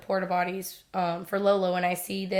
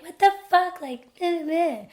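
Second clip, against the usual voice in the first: mock yelling; 8 semitones higher than usual. Friends yelling at boyfriend.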